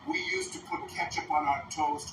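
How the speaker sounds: a hammed-up Russian accent; bad Russian accent. Mocking Malkovich's terrible Russian accent. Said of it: "He does the worst Russian accent ever".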